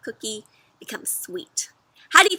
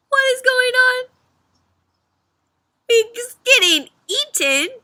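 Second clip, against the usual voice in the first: much higher, louder alarm; 13 semitones higher, 7 dB louder than usual. Acted. Reader shocked the cookie is eaten. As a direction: gasp it out in shock.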